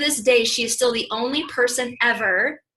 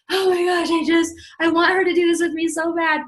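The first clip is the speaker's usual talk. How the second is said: high, louder longing plea; eager pleading; 6 semitones higher, 4 dB louder than usual. Retold or imitated. Hoping a friend will join, eager high pleading. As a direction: gush it with eager desperation. Retold eager inner thought.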